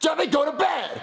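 high, drawn-out angry order; exasperated shout. Acted. Dad at end of patience, exasperated shout. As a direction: order the child to bed at full exasperation.

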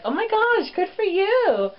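high, gushing fake-nice praise; sugary mock congratulations; fake sweet. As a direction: gush like you mean it, but you don't. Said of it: "So you could be like".

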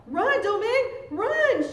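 high, loud cry of alarm; urgent scream. A narrator's reading. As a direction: scream at the child to flee. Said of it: "she screamed".

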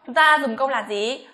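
usual speaking voice

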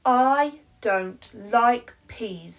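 slow, loud flat refusal; stubborn child. Acted. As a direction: dig your heels in like a stubborn little girl.

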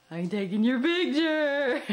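laughing through the words, a bit slow; can't hold back a laugh while filming; giggling while saying it. Real, not acted. Dad laughing while filming toddler.

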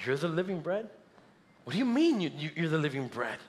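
hushed, puzzled questioning; confused crowd. Acted. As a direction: voice jumps up on "mean", then drops in bafflement. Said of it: "This confused the crowd".

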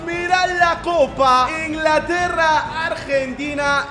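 slow, loud stadium announcement; announcer voice. Acted. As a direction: announce the final grandly. Announcing the final like a broadcast.

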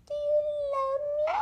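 squeaky high question, rising; high pet voice. Acted. Asking his dog Stitch for love, squeaky high pet voice. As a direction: ask it like talking to a puppy.

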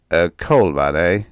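loud, mocking disbelief; scornful, needling echo; sneering. A narrator's reading. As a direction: sneer it back at the child. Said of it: "Durkin sneered".

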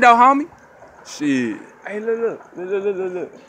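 ordinary talk

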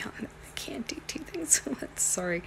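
a soft aside to yourself; whisper. Real, not acted. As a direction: giggly whisper while fumbling. Fumbling with phone, whispering.

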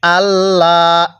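আল্লাহ with a light, thin lam, drawn out slowly; slow recitation of আল্লাহ; thin Allah. Shown as a demonstration. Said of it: "এরকম পড়ব তখন".

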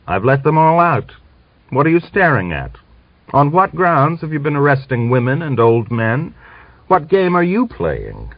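two officers quarrelling over the prison; heated argument. Acted. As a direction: argue hotly, voice up.

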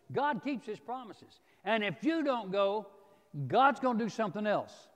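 emphatic warning driven home from the pulpit; forceful preaching. Acted. Preacher voices Mordecai warning Esther about refusing, forceful preaching.

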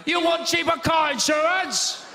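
high, slow, loud mock Chinese meerkat; Chinese accent. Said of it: "if those meerkats were Chinese".

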